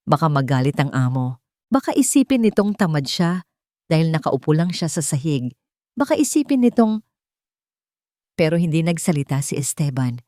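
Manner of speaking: usual speaking voice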